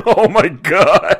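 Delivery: slow, loud, high laughing gasp; laughing disbelief. Real, not acted. Hearing the Interstellar tweet, laughing slowly and loud. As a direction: crack up in disbelief.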